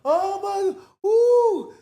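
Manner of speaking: very high, loud crowd whoop; crowd reaction. Retold or imitated. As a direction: imitate an audience erupting in delight. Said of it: "you hear all kinds of shit".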